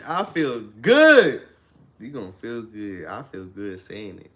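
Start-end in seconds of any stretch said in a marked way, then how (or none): 0.0-1.4 much louder and slow; energetic affirmation for listeners; loud and excited. Shown as a demonstration. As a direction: proclaim it. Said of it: "So if you say".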